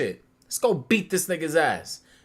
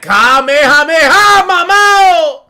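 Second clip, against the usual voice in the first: loud, slow, high hammed-up dub yell; Spanish dub shout; 10 semitones higher, 12 dB louder, slower than usual. Friend mocking the Spanish Dragon Ball dub, shouting loud. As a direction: bellow it like an overacted Spanish voice actor.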